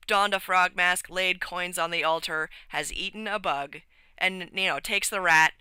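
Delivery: ordinary talk, nothing marked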